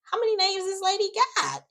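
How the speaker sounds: very high-pitched astonished question; mock incredulity. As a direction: squeak it out in imitated disbelief. Retold astonishment at another name.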